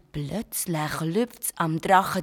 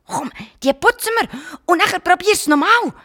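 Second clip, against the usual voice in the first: eager urging; 9 semitones higher, 6 dB louder than usual. Acted. Garnelli eagerly urges cleaning.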